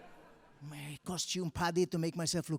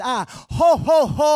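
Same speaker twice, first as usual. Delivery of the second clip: very loud, high booming Santa laugh; hammed-up jolly Santa laugh; 9 semitones higher, 15 dB louder, slower than usual. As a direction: belt out a proper ho ho ho. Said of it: "I said".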